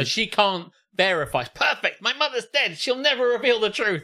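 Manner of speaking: high, gleeful villain voice; mock scheming sister. Mocking the sister's lie, high gleeful gloat.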